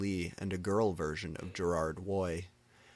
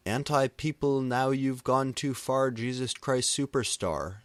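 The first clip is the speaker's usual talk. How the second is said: higher, louder stage scream; mild mock scream; 5 semitones higher, 4 dB louder than usual. A narrator's reading. Singer on stage screaming at the crowd, mock scream. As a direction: bellow it from the stage. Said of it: "screamed Marlon on the stage".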